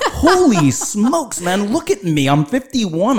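high, mock-outraged reply; fed up with a stranger's organic question, high exasperated; exasperated rant. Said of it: "I'm like".

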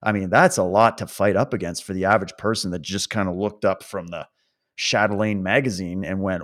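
ordinary talk, nothing marked

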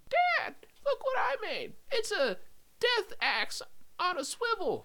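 slow, very high squeaky voice; tiny, high put-on kid voice; helium-voiced son. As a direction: proudly present an invention to dad on helium. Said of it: "sound like you've been inhaling helium".

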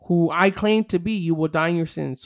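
usual speaking voice

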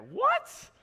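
high-pitched, incredulous yelp. Acted. Preacher reacting to the friends' crazy roof plan, incredulous. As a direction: shoot up high in mock disbelief.

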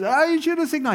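high, loud mock whine of the angry truck driver; angry trucker. Angry trucker voiced in story.

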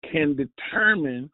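normal talking voice

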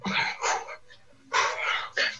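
whispering to himself, nervous; breathy psych-up before the take; whispered self pep talk. Real, not acted. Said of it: "Really need this job".

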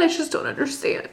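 soft whispered echo of "I just don't understand"; whispered repeat. Retold or imitated. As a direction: whisper it to yourself, defeated. Repeating her confusion to him.

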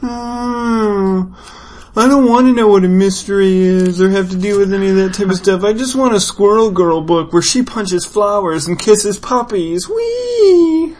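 high, whiny mock fan; mock a gushing online fan. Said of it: "they'd be".

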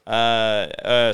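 normal talking voice

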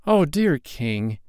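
high, slow plea; a child's timid, trembling appeal. A narrator's reading. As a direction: address him slowly, in a high young voice. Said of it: "he said with a trembling voice".

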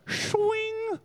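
high, whistling mock whoosh into swing; sling whoosh. Preacher acting David's sling shot, comic whoosh. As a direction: whirl the sling with a rising whistle.

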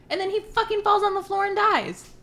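high, loud dramatic outburst; dramatic exclaim. Real, not acted. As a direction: build it to a shout. Dramatic climax of retelling.

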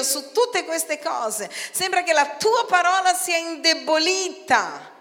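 a prophet's high lament; lamenting prayer. Acted. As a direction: plead with God in despair.

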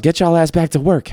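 high-pitched mock yell; retold heckler's yell. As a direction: imitate a passing heckler calling out. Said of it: "this guy yells".

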